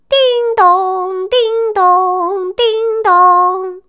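high sing-song ding-dong; imitated doorbell chime. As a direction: ring it like a doorbell. Doorbell ringing in story. (模仿门铃声)

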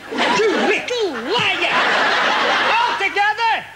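singsong high taunt. Acted. Puppet accuses, repeated.

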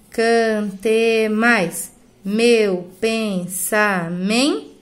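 very slow, drawn-out syllables; stretched, evenly spaced verse beats; syllable-by-syllable scansion. Shown as a demonstration. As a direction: sound out each syllable, ending on the stressed one. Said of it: "O to cai fora".

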